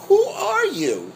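high, drawn-out "who are you"; slow, high-pitched disbelief, hammed up; mock incredulity. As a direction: imitate baffled onlookers, stretched out and high. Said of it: "Looking at me like".

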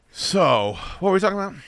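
ordinary talk, nothing marked

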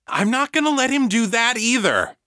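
high-pitched, heated insistence; insistent. A narrator's reading. Egan reassuring the struggling mother, heatedly insistent. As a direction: insist urgently over her protest. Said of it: "Egan insisted".

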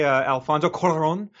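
"Cuarón" with Spanish vowels and rolled r; Spanish pronunciation. Retold or imitated. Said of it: "that thick, uh, authentic pronunciation".

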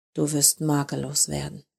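a lover murmuring her promise in hushed tones. A narrator's reading. Hushed, intimate murmur. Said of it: "erklärt sie gedämpft".

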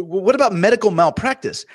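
high-pitched anxious question, replayed; retold worried objection. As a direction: raise the voice in mock worry.

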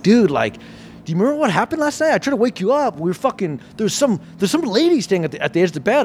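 put-on high, eager girlfriend voice; excited retelling. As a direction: gush it out like spooky gossip. Girlfriend's ghost story quoted.

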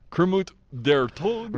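put-on slow, high, loud German accent; mock German accent. Podcaster turning a frog puppet's name German, hammed-up German accent. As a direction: ham up the German, drawn out. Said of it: "do the German accents".

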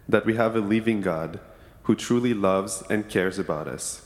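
ordinary talk

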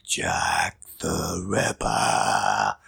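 slow, drawn-out scary whisper. Shown as a demonstration. Host showing off his spooky delivery, scary whisper. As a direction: whisper it long and menacing like a horror host. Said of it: "you do it for me in your scary voice".

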